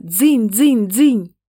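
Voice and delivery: high ding-ding of a bell; bright bell ringing sound; ringing doorbell. Acted. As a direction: chime it like a doorbell.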